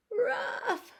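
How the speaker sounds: high crying ruff; sad dog whimper-bark. Acted. Lost Biff barking alone, high and crying. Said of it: "said Biff".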